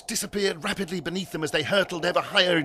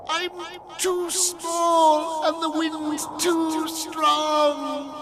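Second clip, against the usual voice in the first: high, slow, wailing squeak; squeaked back; 12 semitones higher, 3 dB louder, much slower than usual. A narrator's reading. Tiny Fiddly answers Algernon in the wind, squeaking. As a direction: squeak helplessly, stretching the words. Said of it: "Fiddly squeaked back".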